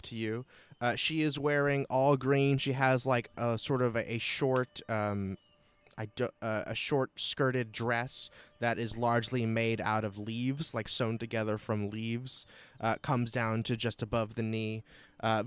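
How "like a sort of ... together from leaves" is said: ordinary talk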